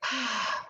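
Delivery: soft happy sigh; contented sigh. Acted. Bear sighs after eating, soft and pleased. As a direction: let out a sigh of delight. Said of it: "He sighs with delight".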